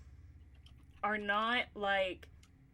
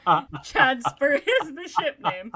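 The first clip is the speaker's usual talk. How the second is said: laughing; 4 semitones higher, 13 dB louder, slower than usual. Real, not acted. Laughing at the ship name.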